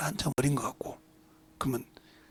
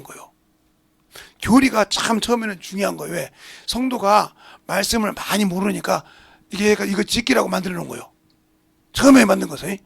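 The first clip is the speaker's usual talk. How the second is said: loud, raised sermon delivery; forceful preaching; 5 semitones higher, 11 dB louder than usual. Acted. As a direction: drive the point home to the congregation. (힘주어 외치는 설교)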